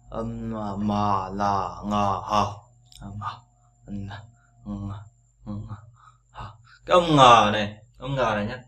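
ordinary delivery

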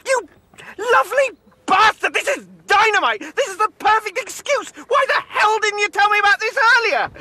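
high, bursting with relief and delight; gleeful outrage. Acted. Mark to Jeremy on hearing the snog, gleeful outrage. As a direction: shout it like the best news ever. Mark thrilled at friend's betrayal.

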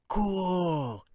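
put-on high excited boy; boyish exclaim. A narrator's reading. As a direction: gasp it like an amazed boy. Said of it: "he exclaimed".

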